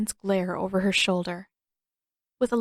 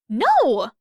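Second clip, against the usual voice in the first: loud, sharp outburst; forceful no; 5 semitones higher, 8 dB louder than usual. Acted. Girl refusing to reveal herself, forceful outburst. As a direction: bark the refusal.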